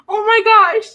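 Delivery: high, loud, breaking into laughter; overwhelmed delight. Real, not acted. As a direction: gasp out your delight, then laugh. Overwhelmed by another super chat.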